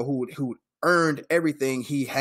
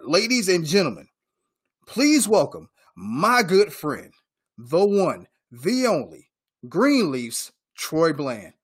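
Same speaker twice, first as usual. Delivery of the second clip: drawn-out ring announcer build with swooping pitch; slow, ceremonious show intro, each phrase rising and falling; announcer introduction; 2 semitones higher, slower than usual. Real, not acted. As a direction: introduce the guest grandly, pausing between phrases. Announces the guest to the audience.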